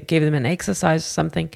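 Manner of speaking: ordinary delivery, nothing marked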